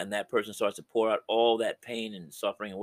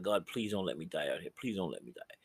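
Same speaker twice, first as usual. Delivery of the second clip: softer, hushed plea for his life; desperate soldier's plea; 3 semitones lower, 9 dB quieter than usual. Retold or imitated. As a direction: beg quietly, as if under fire. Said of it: "you got time to cry out to God".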